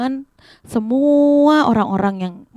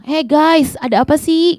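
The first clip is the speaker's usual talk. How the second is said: raised, high "hey guys" call; modern-day call; 5 semitones higher, 4 dB louder than usual. Acted. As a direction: call out like someone today. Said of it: "Kalau misalnya zaman sekarang bilang". (seruan gaul bernada tinggi)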